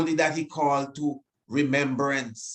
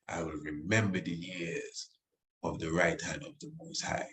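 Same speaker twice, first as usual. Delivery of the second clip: soft, lower reverent reading; hushed reading; 5 semitones lower, 9 dB quieter than usual. Acted. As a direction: let the verse sink to a hush. Rereading the verse softly.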